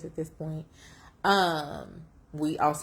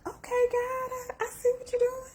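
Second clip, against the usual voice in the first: much higher, awestruck talk to God; amazed to God. Retold or imitated. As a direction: squeak it up in wonder at God. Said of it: "I'm like".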